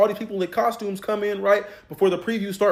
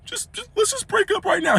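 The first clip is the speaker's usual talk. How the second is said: put-on very high girlfriend voice ending it; mock breakup line; 13 semitones higher than usual. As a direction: imitate her snapping, pitched way up. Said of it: "she was like".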